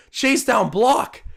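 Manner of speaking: high, slow, rising hype call; excited call. Real, not acted. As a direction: draw out the big moment. Calling a block live.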